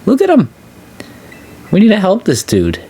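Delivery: high, excited gushing. Real, not acted. Streamer gushing over a dog, high and excited.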